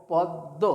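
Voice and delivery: "পদ্ম" split on a held double d, no m; doubled দ in পদ্ম. Shown as a demonstration. Teacher modelling the right form for learners, পদ্ম as "pod-do". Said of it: "তার উচ্চারণটা হবে".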